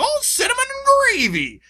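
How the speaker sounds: high, slow, cracked prospector shout; old prospector. Retold or imitated. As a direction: holler it like a grizzled old miner. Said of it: "where he's a grizzled old prospector".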